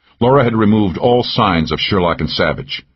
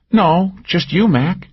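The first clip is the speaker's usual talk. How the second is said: higher, teasing woman's voice; Cal's voice; 13 semitones higher, slower than usual. A narrator's reading. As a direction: needle him with a pitched-up lilt. Narrator voices Cal.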